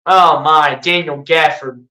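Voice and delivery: ordinary talk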